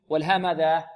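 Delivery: ordinary talk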